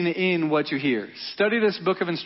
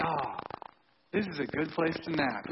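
calm, unbothered; 4 semitones lower, 10 dB quieter than usual. Acted. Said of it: "Jesus is like".

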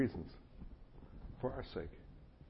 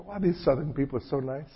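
naive newcomer; 7 semitones higher than usual. Retold or imitated. Naive newcomer voiced in retelling.

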